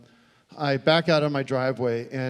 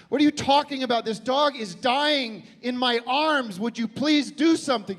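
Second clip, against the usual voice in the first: retold outrage; 8 semitones higher than usual. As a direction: demand she act, voice climbing. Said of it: "I said".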